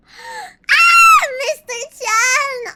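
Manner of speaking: high, loud, slow shriek of shock; a gasp and a shriek at the screen; shocked scream. Acted. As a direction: scream it in fake horror. Mock shock at a chat message.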